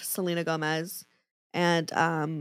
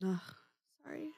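crying; 14 dB quieter, faster than usual. Real, not acted. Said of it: "'cause you're crying".

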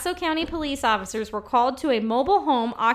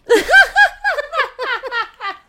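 loud laugh; 14 semitones higher, 10 dB louder than usual. Real, not acted. Funny headline reaction.

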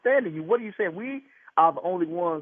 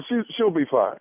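ordinary delivery

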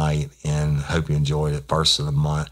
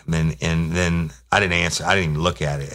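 usual speaking voice

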